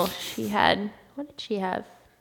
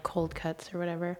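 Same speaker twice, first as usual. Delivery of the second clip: yawning; 16 dB quieter than usual. Real, not acted. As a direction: talk mid-yawn. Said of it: "I'm yawning and coughing".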